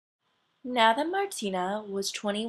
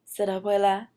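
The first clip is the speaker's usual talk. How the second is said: plain reading voice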